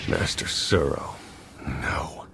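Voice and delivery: breathless, slow stunned whisper; barely gets the words out in shock; horrified whisper. Acted. Theron whispers in horror as his master turns on him.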